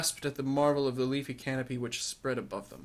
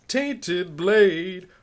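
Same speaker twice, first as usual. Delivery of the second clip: higher and louder, "Tainted blade!" hammed up; mock-dramatic repeat; 6 semitones higher, 7 dB louder than usual. Repeating the line from the dwarf's report in mock horror. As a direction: echo "Tainted blade!" with theatrical mock gravity.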